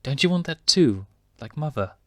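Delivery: innocent cub. Acted. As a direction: ask it plainly, not understanding.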